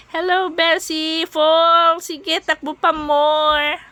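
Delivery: high, bright cheering on. Real, not acted. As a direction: cheer her to keep running. Cheering on jogging Bessie.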